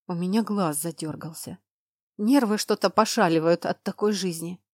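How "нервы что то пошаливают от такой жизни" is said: ordinary delivery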